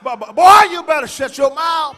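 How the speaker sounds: loud, sharp scolding shout from a mother; mama yelling at her child to hush; angry mama scolding. Retold or imitated. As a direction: bark it like mama mid-whipping.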